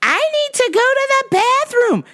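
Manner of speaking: high mocking tattletale voice; whiny coworker. Coworker telling the boss, high mocking whine. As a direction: whine it like a snitch. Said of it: "my rata coworker going".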